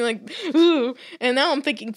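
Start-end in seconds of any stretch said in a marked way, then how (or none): 0.5-0.9 high disgusted ooh; mock heebie-jeebies groan. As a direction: shudder out a creeped-out ooh. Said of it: "walking around the house going".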